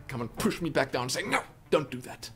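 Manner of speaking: ordinary delivery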